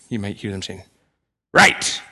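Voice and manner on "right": sudden loud mock bark; one explosive, exasperated shout; impersonated angry dad. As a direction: snap it out like a dad losing his temper. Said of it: "ask them to impersonate my anger".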